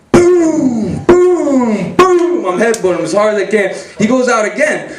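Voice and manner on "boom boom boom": high, falling booms; three ringing hits, each pitched high; imitated headbutt impacts. Headbutting his brother repeatedly, booming impacts. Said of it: "I start headbutting him".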